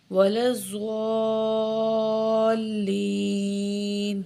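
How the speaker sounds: slow, melodic tajweed chant; Quranic recitation. Shown as a demonstration. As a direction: recite devoutly, drawing out vowels. Fatiha recitation continues.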